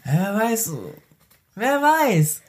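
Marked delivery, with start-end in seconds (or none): 1.6-2.4 pitched up high, teasing and drawn out; mock mysterious. Friend teases about going grey, pitched high. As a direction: float it up high like a playful riddle. Musing aloud during banter.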